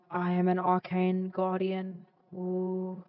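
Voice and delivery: slow, processed guardian voice; slow filtered arcane voice; Arcane Guardian voice effect. Acted. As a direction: sound like a magical construct through a voice changer. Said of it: "I should sound like an Arcade Guardian".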